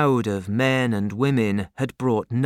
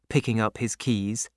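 ordinary delivery, nothing marked

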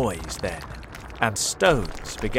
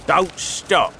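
louder, higher farmer's order; Boggis shouting; 8 semitones higher, 10 dB louder than usual. A narrator's reading. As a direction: bark at the other farmers. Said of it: "Boggis shouted to Bunce and Bean".

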